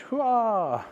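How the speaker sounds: high, hammed-up hurrah; playful high celebratory cry; mock cheer. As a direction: cheer the big reveal in fun. Unveiling the tool.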